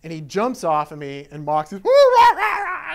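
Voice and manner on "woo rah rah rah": high, loud howling barks; imitated frantic dog. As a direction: bark like a dog freaking out. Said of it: "'cause she's freaking out".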